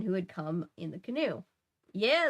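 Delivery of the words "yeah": a loud high yell back. A narrator's reading. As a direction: yell it across the river. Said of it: "the fisherman yelled".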